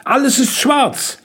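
put-on high-pitched girl's voice; high, emphatic agreement; confirming girl. A narrator's reading. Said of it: "bestätigte Jenny".

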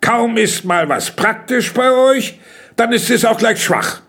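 high, exasperated reproach; reproachful. A narrator's reading. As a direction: complain that it always goes wrong. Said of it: "Kalles Vorwurf".

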